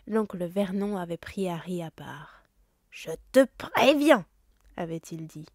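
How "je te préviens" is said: slow, loud, heavy warning; menacing uncle. Acted. Uncle Vernon warning Harry face to face, slow and menacing. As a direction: lean in and threaten.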